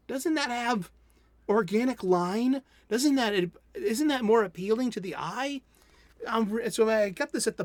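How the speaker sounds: high, loud, thrilled praise; excited gushing. Real, not acted. Comic fan showing viewers the art, excited gushing. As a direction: rave about the lettering like a fan.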